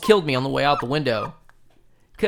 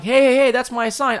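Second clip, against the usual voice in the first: high, loud calling out; 9 semitones higher, 5 dB louder than usual. Retold or imitated. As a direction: shout across the street to stop him. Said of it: "And I'm like".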